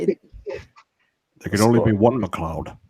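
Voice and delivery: slow Scottish film-star impression; slow, put-on Scottish delivery; Sean Connery. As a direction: draw it out as a Connery impersonation. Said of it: "Dueling Connerys".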